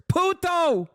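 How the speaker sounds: reading out "Puto" in a mock Spanish voice; mock Spanish slur. Shown as a demonstration. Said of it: "Venezuelan specific slurs".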